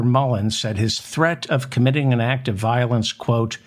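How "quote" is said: normal talking voice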